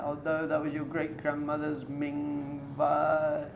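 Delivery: slow, drawn-out mock dismay; upset wife. Wife to rabbi over the smashed heirloom, acted upset. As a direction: wail it lightly, stretching the words. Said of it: "She's very upset".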